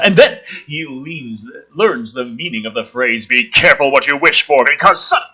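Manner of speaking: booming storyteller read; dramatic script reading. Acted. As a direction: lean into the warning like a trailer voice. Reading screenplay aloud dramatically.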